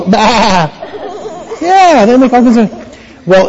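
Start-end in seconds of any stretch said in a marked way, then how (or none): 0.0-0.7 sick sheep's drawn-out bleat. Acted. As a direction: bleat it like a sheep that feels poorly. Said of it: "Kind of a sick sheep says".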